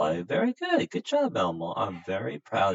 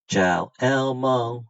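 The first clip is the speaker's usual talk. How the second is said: slow and drawn out, higher on "Elmo"; slow demo greeting; 4 semitones higher, 5 dB louder, slower than usual. Shown as a demonstration. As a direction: model "Ciao, Elmo" slowly again. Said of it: "Okay, ready?".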